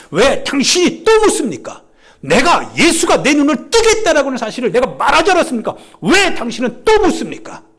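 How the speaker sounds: high, heated confrontation; impassioned challenge. Acted. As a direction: throw the questions at the accusers with mounting force. Healed man confronts the Pharisees.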